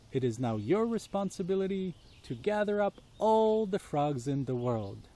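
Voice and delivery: ordinary delivery, nothing marked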